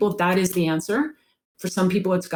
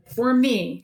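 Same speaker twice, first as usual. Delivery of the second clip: usual speaking voice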